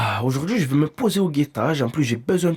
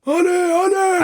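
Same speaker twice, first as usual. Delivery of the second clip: high excited shout of encouragement; shouted cheering; slower than usual. Acted. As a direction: urge them on at full voice. Encouragement crié. (encouragement criée, aiguë et forte)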